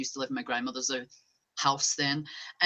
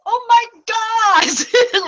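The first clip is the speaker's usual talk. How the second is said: very high, loud, drawn-out scream; a frightened child shrieking at the ghost; startled scream; 13 dB louder, much slower than usual. Retold or imitated. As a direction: shriek it as if a ghost touched you. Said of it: "And I was like".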